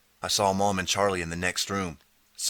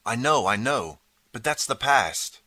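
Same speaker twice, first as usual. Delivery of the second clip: higher, louder, pressing insistence; insisting; 5 semitones higher, 4 dB louder than usual. Acted. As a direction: push back hard, don't let him finish. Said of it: "I insisted".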